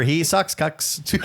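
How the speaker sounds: breaking into laughter mid-word; giggling through the tongue twister; cracking up while reading it; laughing. Real, not acted.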